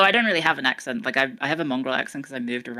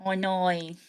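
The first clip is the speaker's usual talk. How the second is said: put-on Australian accent; 3 dB quieter, much slower than usual. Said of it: "I don't have the".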